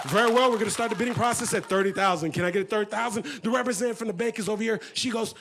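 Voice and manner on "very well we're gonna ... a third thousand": brisk auctioneer starting the bids. Acted. Auctioneer asking the room for a bid, brisk official voice. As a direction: rattle it off quickly like an auctioneer. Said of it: "The auctioneer says".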